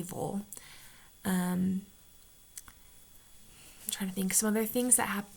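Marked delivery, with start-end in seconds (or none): 0.0-0.4 "Vol" said as vawl, one syllable; ville as vol. Shown as a demonstration. A Utah vlogger showing how she says ville, "Vol" as vawl. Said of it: "Ville at the end, I say Vol".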